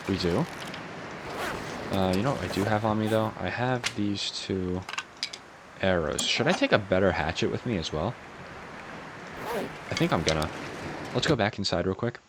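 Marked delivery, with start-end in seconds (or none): none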